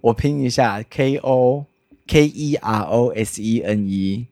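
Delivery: letter by letter, x0.5 pace; spelling it out. Shown as a demonstration. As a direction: spell out each letter slowly.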